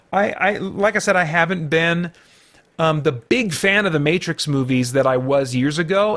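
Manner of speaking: normal talking voice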